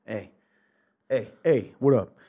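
loud New York street greeting; New York accent. Real, not acted. Host hailing listeners out there, New York accent. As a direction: call out like a New Yorker. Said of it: "I talked like a New York".

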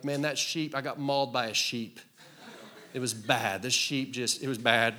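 hammed-up tale of being mauled by a sheep, with a laugh; mock victim story. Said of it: "you don't ever hear about, like".

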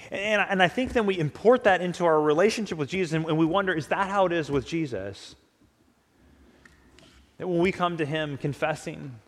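usual speaking voice

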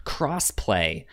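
ordinary talk

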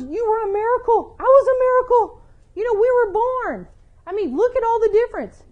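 high, loud, animated voice of Jesus; a lively, eager Jesus reassuring John; imagined Jesus. Pretend. Jesus answering doubting John, high and animated.

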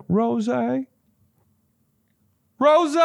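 high-pitched imitated dog call; dog calling at door. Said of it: "he'll be like".

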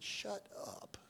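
hushed whisper of shut up; whispering so the meeting won't hear. Retold or imitated. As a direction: hiss it under your breath to a friend.

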